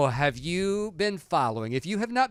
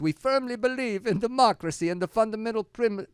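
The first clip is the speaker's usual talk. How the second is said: mock Italian; 6 semitones higher, 4 dB louder than usual. Reading the designers' follow-up.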